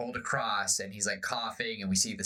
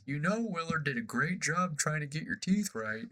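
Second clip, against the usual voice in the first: high whine; 7 semitones higher than usual. Retold or imitated. Crew whines, captain explains.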